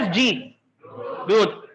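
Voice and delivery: ordinary talk, nothing marked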